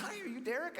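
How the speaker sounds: high mock-girl voice; imitating her. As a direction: squeak it like the date at the door. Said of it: "She says".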